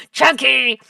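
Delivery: punchy, loud exclamation; loud text-message voice. Shown as a demonstration. Streamer showing how the texts sound, exclaiming loudly. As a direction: burst it out loudly. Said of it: "That's how my text messages sound".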